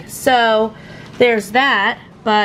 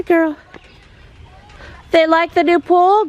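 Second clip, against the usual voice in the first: coaxing call; 9 semitones higher than usual. Real, not acted. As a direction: urge the cow along. Coaxing cow into barnyard.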